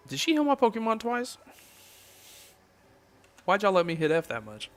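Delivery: high, loud disbelieving yelp; sudden loud gamer disbelief; surprised outburst. Real, not acted. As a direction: blurt it out in shock at the game. Reacting to game surprise.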